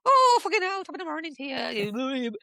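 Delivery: lightly put-on Birmingham Irish accent, high and loud. Teller mimics her Birmingham Irish relatives in a hammed-up Birmingham Irish accent. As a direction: ham up a Birmingham Irish uncle. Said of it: "Birmingham Irish".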